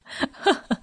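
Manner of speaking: a short, light laugh; Ken's laugh. Acted. Ken laughing at the AI's scolding, short light laugh. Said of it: "Ken laughed".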